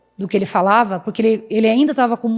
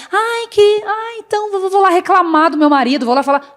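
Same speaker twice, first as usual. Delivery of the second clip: higher-pitched mock complainer; reclamona fingida; 5 semitones higher than usual. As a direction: imitate a wife running off to moan to a friend. Imita quem reclama do marido. (amiga reclamando, em tom agudo de deboche)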